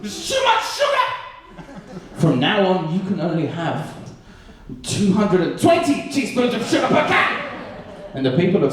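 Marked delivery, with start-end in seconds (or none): 0.0-1.1 hammed-up high-pitched yell; government outcry. Scottish government stepping in over the recipe, hammed-up high outcry. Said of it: "The Scottish government got involved". 2.2-7.4 high, hammed-up proclamation; mock shouted decree. Scottish government announcing the new limit, hammed-up shouted decree.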